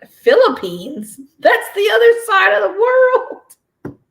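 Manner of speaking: high, slow, loud outburst of disbelief; can't-believe-it squeal at the news; shocked disbelief. Retold or imitated. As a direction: stretch it out in horror. Retold shock at package location.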